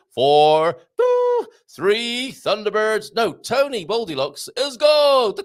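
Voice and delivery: loud launch countdown; mock countdown. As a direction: call it like mission control. Thunderbirds countdown, rocket launch.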